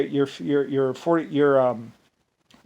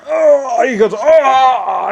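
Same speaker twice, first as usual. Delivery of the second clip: higher, louder hammed-up yelp of pain; mock pained shout; 9 semitones higher, 7 dB louder, much slower than usual. Wounded soldier hit in the arm, hammed-up yelp. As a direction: yelp like a man just shot.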